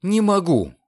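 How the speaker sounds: high, curt refusal; abrupt girl's answer; sharp refusal. A narrator's reading. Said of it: "резко отрезала Рода".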